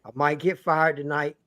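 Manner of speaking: normal talking voice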